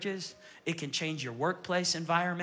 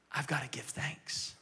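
hushed; 5 semitones lower, 7 dB quieter, slower than usual. Acted. Preacher hushes the key line.

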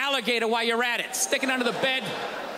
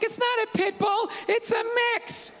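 put-on girlfriend; 9 semitones higher than usual. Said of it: "She's like".

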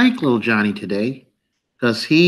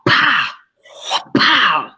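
mock karate hit; 6 semitones higher than usual. Imitating karate hit sound.

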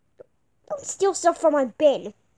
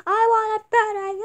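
put-on whiny kid; 6 semitones higher, 5 dB louder than usual. Kid demands, louder.

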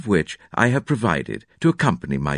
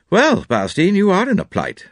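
jovial man voice; 10 semitones higher, 4 dB louder than usual. A narrator's reading. John teasing the muddy doctor.

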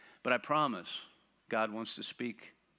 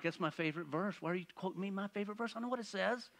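higher, softer, rushed exasperated whine; mock exasperation; 8 semitones higher, 6 dB quieter, much faster than usual. Pastor retelling his reply to wife, mock exasperated. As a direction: grumble it back, half-joking annoyance.

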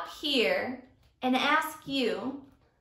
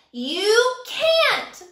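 much higher, loud bellow; 9 semitones higher, 7 dB louder than usual. Acted. Teacher as Sammy, bellowing at Kevin. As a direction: roar the refusal. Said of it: "Sammy bellowed".

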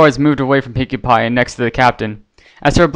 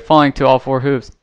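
ordinary delivery, nothing marked

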